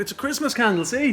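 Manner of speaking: high-pitched mock-innocent pitch; put-on high, pleading cheer; hopeful chancer. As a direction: present it hopefully, as if it passes. Man hopefully presents lighter.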